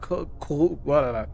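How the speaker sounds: ordinary talk, nothing marked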